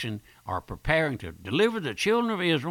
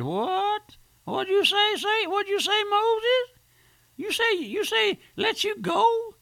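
shrill, much higher mocking voice, sneering at Moses; sarcastic Satan; 4 dB louder than usual. Preacher voicing Satan mocking Moses, high sarcastic sneer. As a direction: play Satan scoffing at the demand, pitch jumped way up. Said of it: "Satan knows how to use sarcasm".

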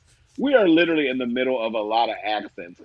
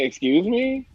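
higher-pitched affronted comeback; taken aback, pitched up; indignant; 8 semitones higher, slower than usual. Retold or imitated. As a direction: act out mild affront. Indignant retort to being called weird.

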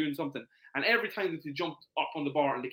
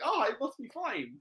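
put-on much higher childlike relief; a small reassured child; mock child voice; 8 semitones higher than usual. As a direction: voice the toddler deciding it's fine. Kid voiced in story.

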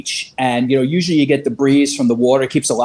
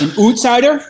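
high Canadian-style "outsider", vowel pushed toward "oot"; questioning, high mock-Canadian accent; 8 semitones higher than usual. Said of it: "They'll know you're an outsider".

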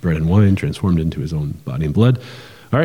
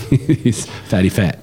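laughing; 2 semitones higher, much slower than usual. Real, not acted. Joking about the fattest calf.

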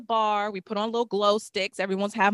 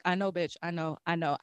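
hushed agreement; 4 semitones lower, 10 dB quieter than usual. Retold or imitated. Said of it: "and I was like".

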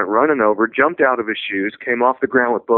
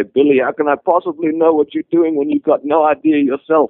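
put-on louder, higher South African accent; 6 semitones higher, 4 dB louder than usual. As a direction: impersonate a South African coach teasing. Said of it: "Cliff would say in that South African, uh, accent of his".

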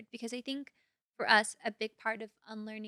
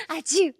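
laughing mock sneeze; 10 semitones higher, 13 dB louder than usual. Mimicking a sneeze.

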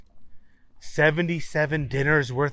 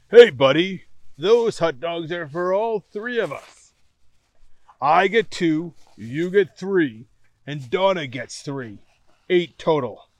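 loud, slowed yell of a captain; 3 semitones higher, 7 dB louder than usual. Acted. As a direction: shout him down over the food. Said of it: "Chet yelled".

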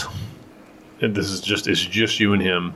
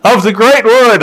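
herald announcement; 14 dB louder than usual. Retold or imitated. Herald announces Relop to the noble party, booming. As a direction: proclaim each name to the hall.

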